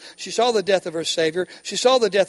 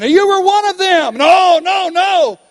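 shouted high denial; loud panicked denial; 9 semitones higher, 8 dB louder than usual. Acted. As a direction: cry it out, no no no. Preacher acting out the denial.

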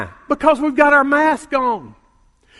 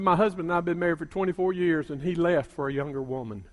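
soft, lower, sad telling; sorrowful; 7 semitones lower, 10 dB quieter, faster than usual. Retold or imitated. Abandoned wife confiding to the pastor, soft and sad. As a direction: let it sink, softly grieved.

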